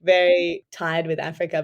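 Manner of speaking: normal talking voice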